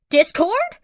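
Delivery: high, loud alarmed disbelief; freaked out. Acted. King Kai hearing Discord is back, freaked out. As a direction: shriek the name in alarm. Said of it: "freaked out".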